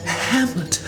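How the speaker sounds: high, tearful plea; pleading. A narrator's reading. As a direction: beg him to stop, near tears. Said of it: "pleads Gertrude".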